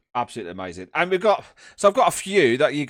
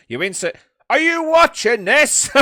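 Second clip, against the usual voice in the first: mock teacher; 10 semitones higher, 8 dB louder than usual. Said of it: "He's like".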